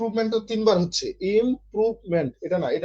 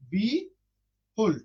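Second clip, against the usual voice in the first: slow demonstration; 4 dB quieter, much slower than usual. Shown as a demonstration. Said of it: "খেয়াল করেন".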